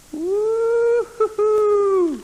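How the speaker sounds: high, loud, long woo-hoo; drawn-out whoop. Real, not acted. Filming himself larking about, high drawn-out whoop.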